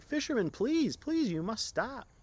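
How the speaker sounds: very high, loud pleading; desperate begging. Acted. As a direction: beg him to stop in desperation. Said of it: "began to cry and beg".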